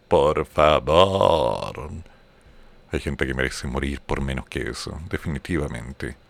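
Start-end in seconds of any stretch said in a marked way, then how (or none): 0.1-1.9 slow drawn out por favor with a rise; exasperated please. Acted. Host fed up with the song, drawn out plea. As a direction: stretch por favor in disbelief.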